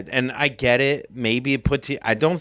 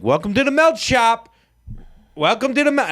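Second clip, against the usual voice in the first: higher, louder forced-cheer welcome; mock cashier greeting; 11 semitones higher, 3 dB louder than usual. Cashier greeting crowds on opening day, hammed-up cheery welcome. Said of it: "he wanted me to do Welcome to Moe's kind of thing".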